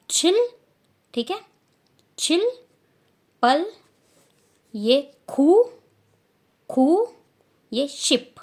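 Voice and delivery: high, loud चिल with a sharp ch; exaggerated pronunciation. Shown as a demonstration. Teacher corrects चिल and finishes the count, high and loud. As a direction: punch out चिल, पल, खू and शिप. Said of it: "not चिल".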